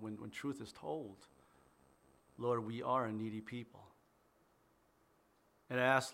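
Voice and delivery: soft, earnest prayer voice. Acted. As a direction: pray quietly and reverently. Opening prayer before sermon.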